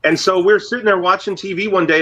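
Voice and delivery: ordinary talk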